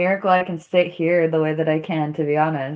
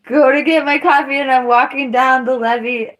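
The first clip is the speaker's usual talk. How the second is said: usual speaking voice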